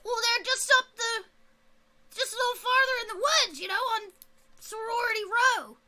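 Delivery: hint of a high goblin voice; lightly voiced goblin. Goblin explains, then directions.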